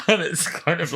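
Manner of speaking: ordinary talk, nothing marked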